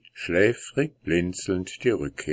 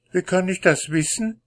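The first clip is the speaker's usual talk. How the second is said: wife voice; 11 semitones higher, 3 dB louder, faster than usual. Acted. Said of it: "sagte die Frau".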